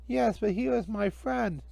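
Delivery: put-on high, slow, worried little girl. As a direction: fret gently for your friend. Karen's voice.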